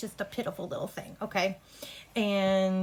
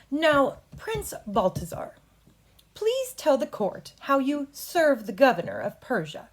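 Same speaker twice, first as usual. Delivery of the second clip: higher, slow, formal lawyer; formal courtroom address; 6 semitones higher, 4 dB louder than usual. Shown as a demonstration. Coach demos lawyer question.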